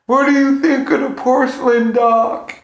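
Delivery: high yelled question from afar; retold scream. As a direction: shout it up high across a distance. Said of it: "he screamed at Anthony Cumia".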